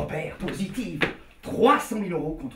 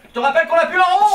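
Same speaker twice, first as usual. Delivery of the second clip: high, loud reminder; too loud; 10 semitones higher, 11 dB louder, faster than usual. Acted. Schemer reminding his partner they are broke, too loud. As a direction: blurt it out far too loudly. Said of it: "pas si fort".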